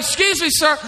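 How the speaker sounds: high-pitched, protesting voice; mock a startled passerby objecting; imitated bystander. People calling to running dad, high mock protest. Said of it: "People are like".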